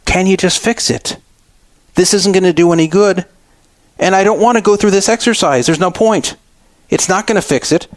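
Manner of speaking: retold argument, pleading in mild exasperation; exasperated pleading. Customer arguing with unhelpful store employee, mildly exasperated.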